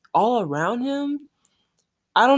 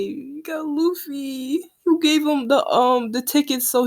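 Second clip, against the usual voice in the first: touched squeal; 7 semitones higher than usual. Real, not acted. Reacting to a touching panel.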